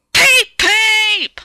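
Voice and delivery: high, loud angry peep peep like a whistle. A narrator's reading. Thomas the engine blowing his whistle crossly at a signal. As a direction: blast it out as a cross train whistle. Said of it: "he blew an angry".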